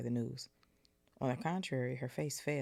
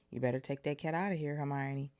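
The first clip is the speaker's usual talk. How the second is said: ordinary delivery, nothing marked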